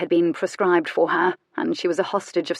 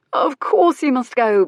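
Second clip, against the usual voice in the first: insistent urging; 6 semitones higher, 6 dB louder than usual. A narrator's reading. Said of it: "both he and Lisbeth said at once".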